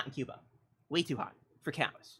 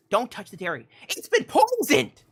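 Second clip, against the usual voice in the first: panicked aides; 5 semitones higher, 7 dB louder than usual. Retold or imitated. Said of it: "his aides came running".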